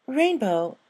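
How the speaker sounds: high, slow, covering surprise; hidden surprise. Acted. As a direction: act unsurprised but it slips through. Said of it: "trying to hide the surprise in her voice".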